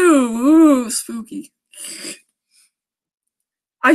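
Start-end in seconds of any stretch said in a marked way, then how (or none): 0.0-1.5 a hammed-up ghostly ooh; mock spooky ooh. Mocking the killer's note with a spooky ooh. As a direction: tease the threat with a spooky coo.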